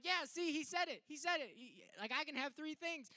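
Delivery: put-on high, loud gloating kid voice; gloating little kid. Little [name] gloats to his parents, high and loud. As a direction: crow that big brother agreed. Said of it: "I'm like".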